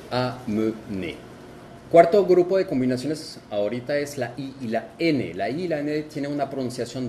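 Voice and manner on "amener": amener said slowly, read as written; French amener, slow and clear. Shown as a demonstration. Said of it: "Ahí se lee cómo se escribe".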